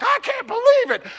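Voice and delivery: high, squeaky "I can't believe it"; mocking disbelief. Pastor mimics shocked churchgoers online, mock high squeal. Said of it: "path of like".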